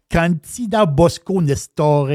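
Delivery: slow, careful Italian pronunciation; practised Italian accent. Shown as a demonstration. Host presenting a winery to listeners, practised Italian accent. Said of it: "vous voyez comme j'ai pratiqué mon italien".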